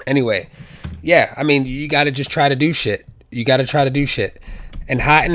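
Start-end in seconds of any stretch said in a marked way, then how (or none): none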